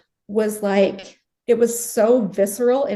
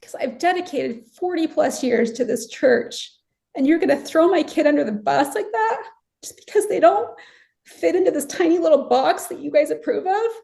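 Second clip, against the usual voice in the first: high-pitched, indignant protest; hurt and exasperated reproach; frustrated; 6 semitones higher than usual. Retold or imitated. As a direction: argue back, aggrieved, pitch raised. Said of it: "I was so frustrated".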